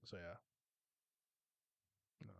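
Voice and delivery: normal talking voice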